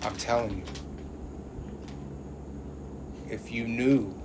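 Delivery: low, hushed, with heavy pauses; imitating ominous warning. Acting out the whole video, low hushed ominous imitation. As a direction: mimic someone hinting at a dark secret. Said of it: "did the whole video like".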